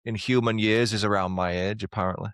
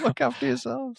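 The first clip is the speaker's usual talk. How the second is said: very high, silly put-on voice; mock sign-off. Host joking a goodbye to listeners, silly high voice. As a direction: squeak it out far above your usual pitch.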